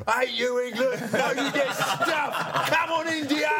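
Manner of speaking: imitated British crowd yell, very high; thick Yorkshire-Cockney heckle. Player imitates India fans booing him, Yorkshire-Cockney accented yelling. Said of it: "thick Yorkshire accents and, like, Cockney accents".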